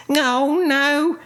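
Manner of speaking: high cry of alarm; shouted dismay. A narrator's reading. Winnie sees rabbits on her rocket, high dismayed cry. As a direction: cry out high, without much added volume. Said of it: "shouted Winnie".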